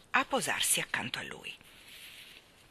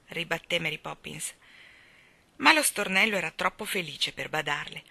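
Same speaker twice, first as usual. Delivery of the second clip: usual speaking voice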